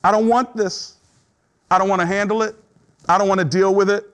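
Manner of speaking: high, strained plea of being overwhelmed; depression. Acted. Preacher voicing what depression says, in overwhelmed protest. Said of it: "Depression says".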